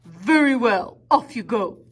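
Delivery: loud, mean and big troll voice. Acted. Troll bellowing down at the little goat from under the bridge. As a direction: boom it out like a big ugly troll. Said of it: "mean and big and bad and ugly".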